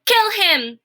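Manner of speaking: high, loud command; shouting Cook. A narrator's reading. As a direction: bark the kill order. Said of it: "shouted Cook".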